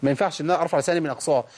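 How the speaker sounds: ordinary delivery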